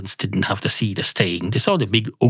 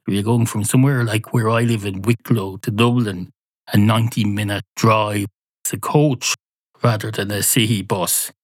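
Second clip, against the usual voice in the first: ordinary delivery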